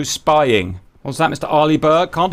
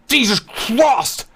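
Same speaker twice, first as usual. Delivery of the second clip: ordinary talk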